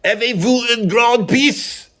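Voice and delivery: high, slow, hammed-up French accent; mock French. Vlogger tries French after admitting he can't, hammy French accent. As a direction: camp it up in a bad French accent. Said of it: "I can't speak French".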